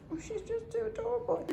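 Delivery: high, soft, laughing delight. Real, not acted. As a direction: giggle it out fondly. Delighted with the dog.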